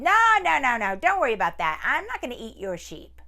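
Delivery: high, loud eager tiger reassuring fast. Acted. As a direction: tiger brushing it off, no no. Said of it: "And the tiger said".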